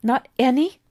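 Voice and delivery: put-on eager boy David. David presses in disbelief.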